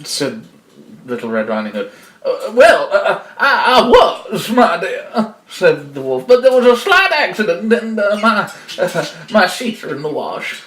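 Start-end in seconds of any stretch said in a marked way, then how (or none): 2.2-5.3 high, stammering granny voice; flustered fake grandma. Wolf explaining why he is out of bed, flustered mock-grandma. Disguised wolf makes excuses. 6.3-10.6 high, stammering granny voice; flustered fake grandma. Wolf explaining why he is out of bed, flustered mock-grandma. Disguised wolf makes excuses.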